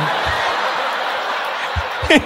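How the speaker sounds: breathless wheeze of laughter; can't stop laughing; real wheezing laugh at the fall. Real, not acted. Laughing at man falling.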